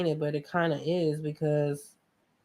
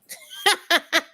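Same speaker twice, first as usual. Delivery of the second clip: high burst of laughter; 11 semitones higher, 5 dB louder than usual. Real, not acted. Laughs at her own line.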